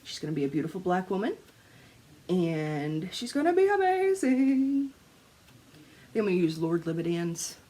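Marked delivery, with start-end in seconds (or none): 3.1-4.9 gushing. Real, not acted. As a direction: stretch it out high with anticipation. Gushing over planned piece.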